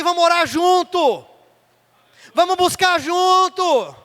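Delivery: loud, stretched preacher's cry; fervent Pentecostal preaching, loud and drawn out; preaching at full force. Acted. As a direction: preach it out to the church.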